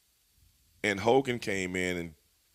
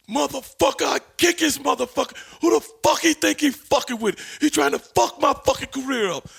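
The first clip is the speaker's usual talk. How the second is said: much higher, louder furious wrestler rant; hammed-up imitation of an enraged wrestler cursing; Randy Savage rant; 7 dB louder than usual. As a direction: rant like a raging wrestler, pitched way up and loud. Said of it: "goes into a John Tenta type rap".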